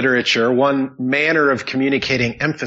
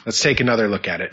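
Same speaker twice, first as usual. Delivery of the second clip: ordinary talk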